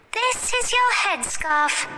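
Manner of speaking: put-on high-pitched excited girl; high girlish storybook voice; little girl character. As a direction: show off a find in a high child's voice.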